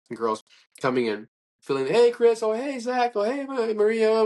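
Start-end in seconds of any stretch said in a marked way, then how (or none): 1.9-4.2 high, sing-out hellos; acted greetings. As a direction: call out to friends in a high voice. Greeting friends as they come in.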